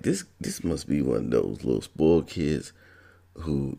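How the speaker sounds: ordinary delivery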